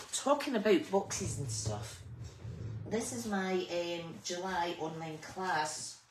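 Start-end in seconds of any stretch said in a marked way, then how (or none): none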